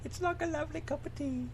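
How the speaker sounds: high, soft fake British tea line; hammed-up British accent; mock British accent. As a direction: imitate a Brit poorly. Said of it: "That's not how you say it".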